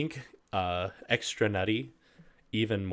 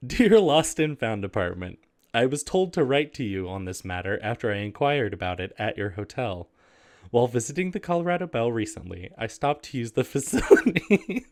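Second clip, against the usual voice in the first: raised, overly proper read-aloud voice; letter reading voice; 4 dB louder than usual. Acted. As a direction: read it like a prim formal letter. Reads next letter aloud.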